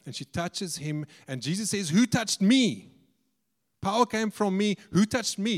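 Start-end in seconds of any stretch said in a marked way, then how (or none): 1.9-5.6 high, emphatic questioning; raised-voice demand. Retold or imitated. Preacher quoting Jesus to the crowd, raised and urgent. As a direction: call it out higher, demanding to know.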